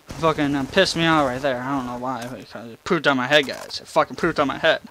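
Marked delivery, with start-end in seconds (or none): none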